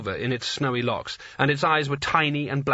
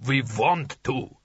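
slow, rumbling alien giant; much slower than usual. A narrator's reading. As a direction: rumble it slowly like an ice giant. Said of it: "said the giant".

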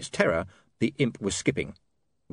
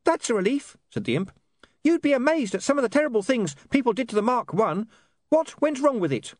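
high, chirpy little imp; bright eager gadget creature; high-pitched relieved imp; 13 semitones higher than usual. A narrator's reading. Imp speaks.

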